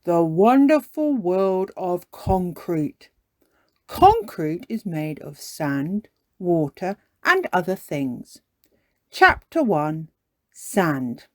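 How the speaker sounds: slow, measured bedtime reading; Daddy Pig. Acted. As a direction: read it out ponderously, like a dull textbook. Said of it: "Daddy Pig begins to read".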